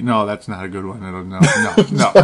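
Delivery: ordinary talk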